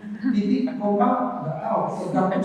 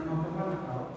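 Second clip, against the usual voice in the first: writer's intent; 13 dB quieter, faster than usual. Shown as a demonstration. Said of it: "padahal maksud penulis".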